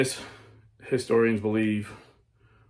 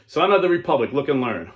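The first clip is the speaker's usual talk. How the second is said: much higher and louder call; loud solemn proclamation; booming mysterious voice; 4 semitones higher, 7 dB louder than usual. Acted. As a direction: proclaim it like a heavenly summons. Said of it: "the mysterious voice saying".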